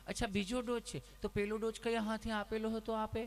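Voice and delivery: a hint of a sugary nurse; sweet nurse. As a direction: ask gently like a caring nurse. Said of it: "તમે કેટલું પ્રેમથી પૂછો છો".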